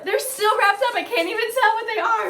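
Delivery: high-pitched, overwhelmed wail; half cry with excitement; real happy tears in a high voice; tearfully excited. Real, not acted. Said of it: "Why am I crying right now?".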